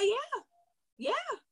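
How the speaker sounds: a client suddenly keen, mock eager yeah; eager yes. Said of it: "now her whole tone changed".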